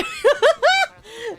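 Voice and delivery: high squealing laugh. Real, not acted. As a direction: burst out laughing. Laughing after retelling.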